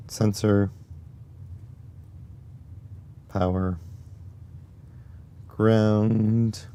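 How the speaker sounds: hoarse scratchy voice; rough raspy throat. Real, not acted. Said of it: "My raspy voice".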